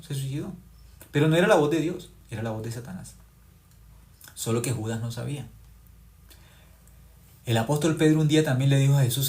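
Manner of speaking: ordinary talk, nothing marked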